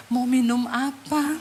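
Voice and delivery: slow, gentle hostess voice; softened, courteous question, acted out; changed, polite offer. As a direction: offer a drink sweetly and unhurriedly. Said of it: "Ih, langsung loh berubah".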